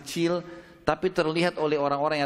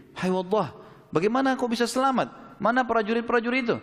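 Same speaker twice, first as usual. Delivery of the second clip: higher, astonished questions to Waddah; amazed crowd; 9 semitones higher than usual. Acted. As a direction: ask in disbelief, pitched up high. (orang-orang bertanya dengan heran dan suara tinggi)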